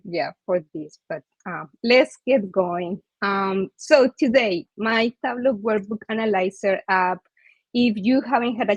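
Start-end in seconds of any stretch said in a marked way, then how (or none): none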